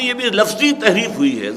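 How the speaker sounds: ordinary talk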